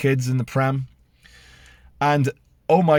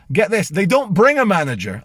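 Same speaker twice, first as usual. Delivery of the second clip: normal talking voice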